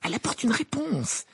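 high-pitched, thrilled announcement; overexcited Ron. A narrator's reading. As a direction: blurt out the news with excitement. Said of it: "dit Ron d'un air surexcité". (surexcité)

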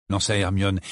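ordinary delivery, nothing marked

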